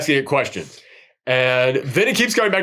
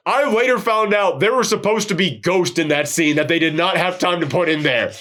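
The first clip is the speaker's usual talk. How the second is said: usual speaking voice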